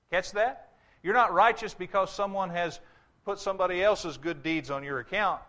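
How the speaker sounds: ordinary talk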